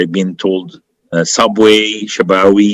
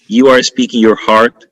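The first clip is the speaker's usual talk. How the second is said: ordinary talk, nothing marked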